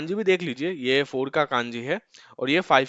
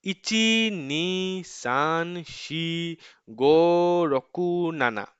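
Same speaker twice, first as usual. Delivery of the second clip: slow counting drill; 3 semitones higher, much slower than usual. Shown as a demonstration. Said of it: "one to seven हम क्या बोलेंगे अब".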